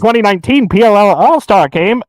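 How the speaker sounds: ordinary talk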